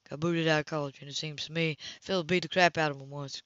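usual speaking voice

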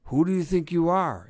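loud, high, affronted challenge; indignant outburst. A narrator's reading. Inspector interrupted in the shower, indignant. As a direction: tear into the caller indignantly.

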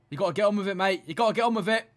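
normal talking voice

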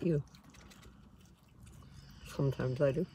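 chewing while talking; talking through a bite; mouth full. Real, not acted. Seen 0: Label unclear; right speaker bites sandwich, then talks while chewing.

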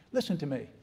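ordinary talk, nothing marked

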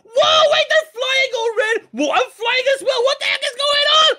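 high, loud disbelief; astonished shouting. Acted. Player finds himself flying with the birds, astonished shouting. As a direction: yell in rising bewilderment. Player suddenly starts floating.

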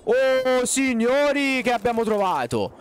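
high, loud cry of delight; thrilled announcement to viewers; excited shout. Real, not acted. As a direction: shout out the big find. Finds loot, thrilled.

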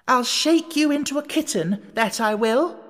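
high, fierce threat; threatening. A narrator's reading. Alice threatening to shake the Red Queen into a kitten, fierce. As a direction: shake her with the words.

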